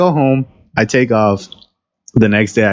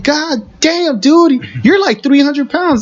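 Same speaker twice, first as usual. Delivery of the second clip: much higher, disbelieving complaint; incredulous protest; 13 semitones higher than usual. Retold or imitated. Small worker protesting to huge supervisor, high incredulous voice. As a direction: protest in outraged disbelief at the boss.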